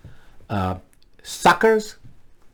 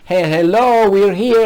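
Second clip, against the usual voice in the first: raised, higher calling voice; protesters calling out; 6 semitones higher, 5 dB louder than usual. Acted. Protesters to elected representatives outside, calling out. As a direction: call out to be noticed. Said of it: "you know, saying".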